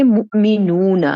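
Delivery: مؤمنون said slowly, drawn-out final اونا sound; slow model word. Shown as a demonstration. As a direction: stretch مؤمنون, lean on the end. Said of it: "اونا کی آواز آپ نے لکھنی ہے". (مؤمنون کو آہستہ، اونا کی آواز کھینچ کر)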